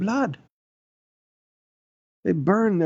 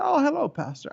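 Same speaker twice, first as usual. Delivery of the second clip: much higher, mock-innocent greeting; caught-out young man; 10 semitones higher than usual. Young church member caught swearing greets pastor, higher voice. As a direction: lightly voice a youth greeting his pastor, pitched up.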